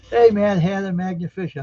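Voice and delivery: greeting a chat regular by name, loud and bright; excited greeting. Real, not acted. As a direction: call out a cheery hello.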